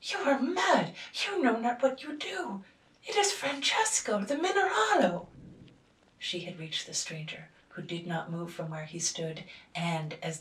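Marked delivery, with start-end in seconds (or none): 0.0-5.3 much higher, louder, frantic; alarmed warning. A narrator's reading. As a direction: warn her frantically. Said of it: "cried the relations".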